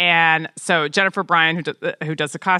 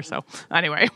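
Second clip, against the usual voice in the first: usual speaking voice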